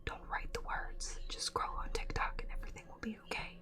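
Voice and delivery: hushed, breathy creepy whisper; eerie whisper of a writer's brain urging procrastination. Pretend. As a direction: whisper it like a spooky secret. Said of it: "I'm done being creepy now".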